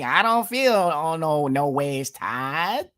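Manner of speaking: high, then slow, put-on gospel-style imitation; mocking impression sung-spoken, stretched slow at the end; mock Hillary Clinton. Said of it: "Hillary Clinton did the same thing".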